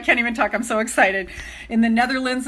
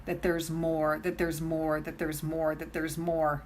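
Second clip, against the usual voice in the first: heartfelt and soft; 6 semitones lower, 7 dB quieter than usual. Real, not acted. Telling her community she loves them, soft and sincere. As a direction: pour your heart out, nearly murmuring.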